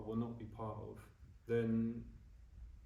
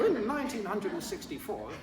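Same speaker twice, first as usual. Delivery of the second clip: higher, laughing imitation of an old-timer; mock old Victorian; 8 semitones higher, 4 dB louder than usual. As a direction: play a stuffy Victorian granddad for a joke, half-laughing. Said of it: "I feel like I'm Victorian".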